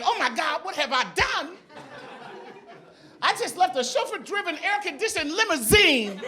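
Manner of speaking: mock panic. Panicked self-quote in hot subway.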